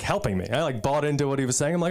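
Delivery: normal talking voice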